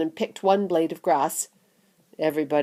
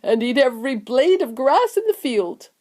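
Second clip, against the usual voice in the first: higher, grinning grandfather voice; delighted; 5 semitones higher than usual. A narrator's reading. Grandpa Joe relishing the sugary field, delighted. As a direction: get carried away with gleeful relish. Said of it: "grinning with delight".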